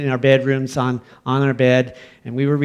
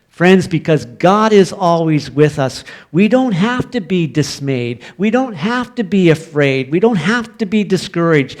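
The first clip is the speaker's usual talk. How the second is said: rising, louder preaching repeats; 4 semitones higher, 3 dB louder than usual. Acted. Pastor reassuring the congregation about fear, fervent preaching. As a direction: drive it home to the congregation.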